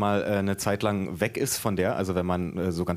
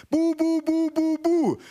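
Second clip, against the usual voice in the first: very high, monotone cooing syllables; besotted aunt's squeaky baby babble; babbling baby-talk aunt; slower than usual. A narrator's reading. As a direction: chant nonsense at a baby in falsetto. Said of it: "die menschliche Sprache verlernt".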